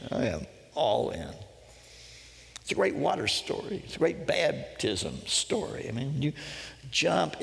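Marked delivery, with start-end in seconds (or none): 0.1-1.3 measured, sober statement with a falling end; sober declaration. Shown as a demonstration. Preacher restates the leap as commitment, sober declaration. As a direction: state it deliberately, word by word. Repeated soberly after the joke.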